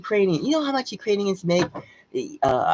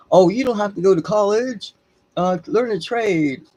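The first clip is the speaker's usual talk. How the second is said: mock advice voice; 5 dB louder than usual. Mocking what people are told.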